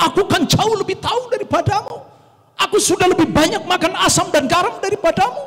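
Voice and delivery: high-pitched, puffed-up know-it-all; boastful superiority. Acted. Preacher mimics elders boasting of experience, high-pitched and self-important. Quotes people boasting of seniority.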